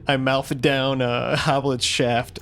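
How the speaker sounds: slow, drawn-out suggestive delivery; milking the words. Real, not acted. Player slowly milking a shaft joke for the table, suggestive. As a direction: stretch it out for the innuendo. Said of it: "Did you really have to say it like that".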